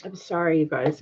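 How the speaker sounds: teary, soft apology through sniffles; real tears, apologising quietly; tearful. Real, not acted. Sniffling on livestream, tearfully sorry to viewers. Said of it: "Now we're all crying".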